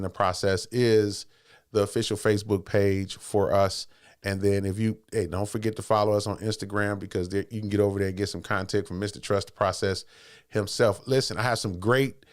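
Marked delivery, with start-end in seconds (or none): none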